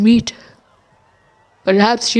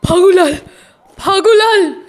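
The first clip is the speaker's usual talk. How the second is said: high call across a distance; 8 semitones higher than usual. Acted. Bishu searching for Phagulal, high urgent call.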